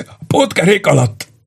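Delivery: tortured man giving in; blurting it out. A narrator's reading. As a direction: force the words out in pain. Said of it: "bökte ki Rollo".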